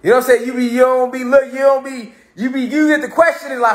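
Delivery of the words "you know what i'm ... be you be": usual speaking voice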